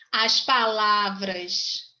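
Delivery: very slow dictation, stretched syllables; slow teacher dictation; dictating. Real, not acted. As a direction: draw it out for students writing it down. Teacher dictating notes to students.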